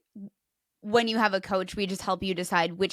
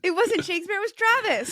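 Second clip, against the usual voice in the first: playful; 11 semitones higher, 4 dB louder than usual. Real, not acted. Joking, high laughing voice.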